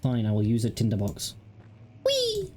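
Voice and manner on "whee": very high, gleeful squeal; gleeful whee. Real, not acted. Throwing an object in game.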